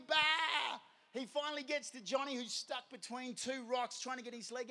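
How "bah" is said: loud, high imitated bleat; sheep bleat. Lost sheep answers the shepherd, bleating. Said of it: "And it's like".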